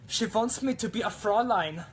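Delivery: a light, high mock Fräulein in a German accent; German girl voice. Viewer asks for a German girl, so he tries a high mock German voice. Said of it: "German girl".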